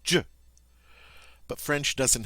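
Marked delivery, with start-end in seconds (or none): 0.0-0.3 a short J as in jam; demonstrating the English J; English J sound. Shown as a demonstration. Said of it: "our J sound ... are the same".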